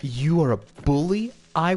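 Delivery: slow, drawn-out indignant complaint; indignant protest. Real, not acted. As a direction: protest word by word, stretching it out. Protests at being bullied in game.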